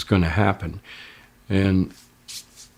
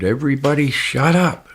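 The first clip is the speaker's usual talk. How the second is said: sharp, higher call to shut everyone up; retold shout; 6 semitones higher than usual. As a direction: cut through the panic with a quick yell. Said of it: "he just shouted".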